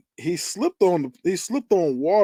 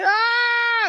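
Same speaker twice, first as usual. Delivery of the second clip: a short, much higher scream imitating the victim; high mock scream. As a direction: let out the character's terrified shriek. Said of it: "She's screaming, like".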